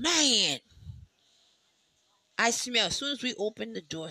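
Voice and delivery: normal talking voice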